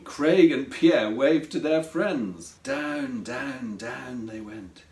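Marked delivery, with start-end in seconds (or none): 2.6-4.8 each down lower and softer; descending downward. A narrator's reading. Narrator lowers the basket down to the beach, sinking voice. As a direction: let the words sink step by step.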